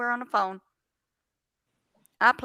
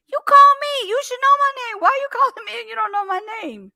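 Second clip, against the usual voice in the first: very high, loud indignant retort; shrill mock outrage at a caller; exasperated outrage; 13 semitones higher, 7 dB louder than usual. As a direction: squeal it in disbelief.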